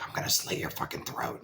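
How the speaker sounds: hushed, mock-threatening whisper; low whispered mock menace; menacing whisper. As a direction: breathe it like a horror-movie threat. Menacing note he didn't write.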